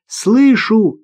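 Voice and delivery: put-on high-pitched child's reply; girl's voice. A narrator's reading. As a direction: call back as a little girl. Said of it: "ответила девочка". (девочка отзывается высоко)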